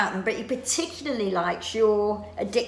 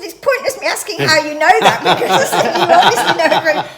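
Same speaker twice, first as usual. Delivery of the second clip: surprised laughing reply; 6 semitones higher, 10 dB louder than usual. Retold or imitated. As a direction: laugh through the words.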